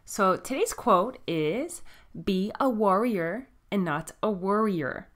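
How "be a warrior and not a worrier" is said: ordinary talk, nothing marked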